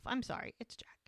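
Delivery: very soft confiding mock apology; whispered aside. Real, not acted. Fan excuses her giving in to Jack, whispered aside.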